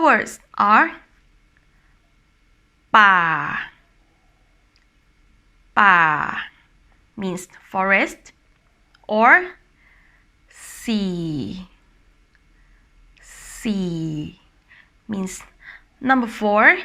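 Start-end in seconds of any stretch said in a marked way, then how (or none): 2.9-6.4 "ba" falling to a low pitch. Shown as a demonstration. Teacher giving the word for forest, low tone "ba". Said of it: "low tone". 10.5-14.4 soft "sea" drawn out and sinking low. Shown as a demonstration. Teacher giving the word for number four, low tone "sea". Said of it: "low tone".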